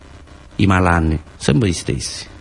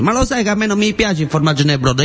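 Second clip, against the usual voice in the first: indignant protest; 11 semitones higher than usual. Acted. Quarrel over cheese in broth.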